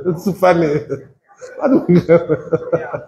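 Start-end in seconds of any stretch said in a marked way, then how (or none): 1.6-3.0 laughing. Real, not acted. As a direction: say it while cracking up. Preacher laughing through lines.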